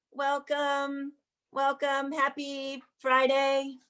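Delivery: slow, stretched happy Friday; "Happy Friday" pulled long and slow; drawn-out greeting. Real, not acted. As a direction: draw out "Happy Friday" slowly and fondly. Host greeting audience at opening.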